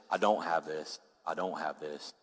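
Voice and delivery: very low, soft, sinking voice; acted quiet admission. Preacher voicing the fallen admitting defeat, low and quiet. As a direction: let it drop to a defeated murmur.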